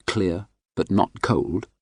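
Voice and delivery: usual speaking voice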